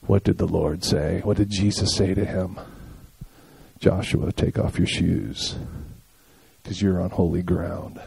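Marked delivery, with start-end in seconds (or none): 3.8-8.0 God's voice. Retold or imitated. God's voice to Joshua.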